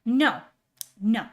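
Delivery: normal talking voice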